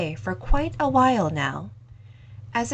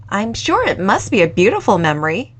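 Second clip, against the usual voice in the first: put-on louder, friendly young woman voice; a lively young cafe owner, voiced louder; 9 dB louder, faster than usual. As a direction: owner kindly offering the lingering customer more time, louder. Owner replies.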